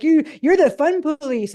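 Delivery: high, drawn-out mock accusation; imitated teen complaint. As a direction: mimic a teen protesting at mom. Complaining teen son retold.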